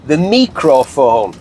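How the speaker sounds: slow, mock-posh "mee-cro-wah-vay" for microphone; Nigella Lawson. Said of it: "As Nigella Lawson would say".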